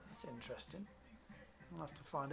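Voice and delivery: a soft, voiceless aside, half to himself; hushed aside. Real, not acted. Commentator wondering about a rider's DNF, whispered aside. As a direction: drop to a whisper as if thinking aloud.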